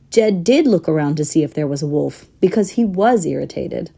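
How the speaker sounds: ordinary delivery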